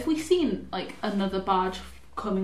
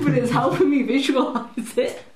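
laughing, loud; 5 semitones higher, 8 dB louder than usual. Real, not acted. Laughing protest.

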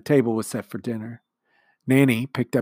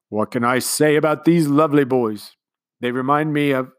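commander's speech; 4 semitones higher, 8 dB louder than usual. A narrator's reading. Commander speechifying to the household, sentimental. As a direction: speak like a sentimental host.

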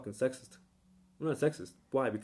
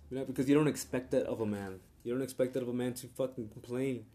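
normal talking voice

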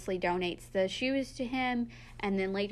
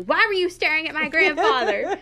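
much louder, higher, playful scolding; loud mock scolding; 7 semitones higher, 10 dB louder than usual. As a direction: scold the photo loudly for laughs. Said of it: "she says really loudly".

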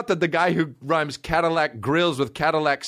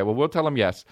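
normal talking voice

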